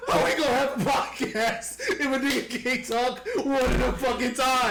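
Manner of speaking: high, laughing complaint. Real, not acted. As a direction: scold while laughing. Laughing rant at friends.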